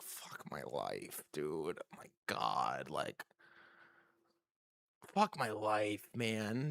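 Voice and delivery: hushed slow self-pity; despairing mutter. Retold or imitated. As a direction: sink into defeat quietly.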